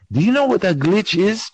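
usual speaking voice

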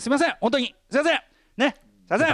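high mock-humble apology; ultra polite japanese. Mock apology for receiving PS5.

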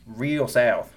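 drawn-out southern vowels; demonstrating a southern drawl; deep southern accent. Shown as a demonstration. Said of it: "I can go s-, real south".

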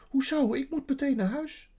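high-pitched anxious outburst; panicky, voice jumping up high; worried girl wanting out; sudden fear. A narrator's reading. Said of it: "de angst kneep haar keel dicht".

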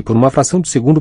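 ordinary delivery, nothing marked

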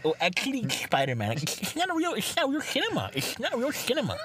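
high, mock snobbish film buff; mocking film snob. Friend mocking a film snob, high sneering voice. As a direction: sneer like a pretentious cinema snob. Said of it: "you're like one of those".

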